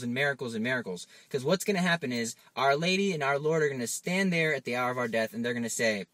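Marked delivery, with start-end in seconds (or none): none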